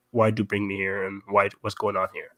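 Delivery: normal talking voice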